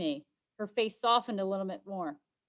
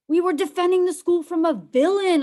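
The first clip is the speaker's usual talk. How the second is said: defiant insistence; 11 semitones higher, 13 dB louder than usual. Acted. Defiant hero insisting to rival.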